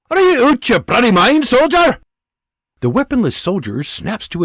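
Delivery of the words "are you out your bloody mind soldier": loud, high Scottish bark; Scottish sergeant shout. Acted. Scottish sergeant shouting at a trigger-happy private. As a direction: roar at the fool in a Scottish accent. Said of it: "he shouts".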